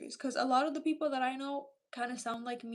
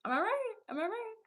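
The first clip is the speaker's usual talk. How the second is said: playful high teasing; 9 semitones higher than usual. Real, not acted. Teasing chat after praising Romanians.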